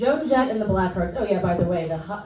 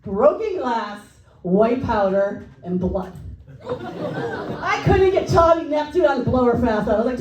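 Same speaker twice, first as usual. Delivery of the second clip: normal talking voice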